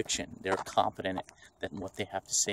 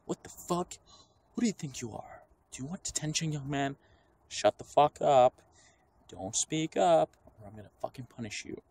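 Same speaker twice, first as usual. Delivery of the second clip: high, ranting strict teacher; mock angry teacher; 5 semitones higher than usual. Imitating a teacher scolding a pupil, high mock rant. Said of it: "If you're too emotional".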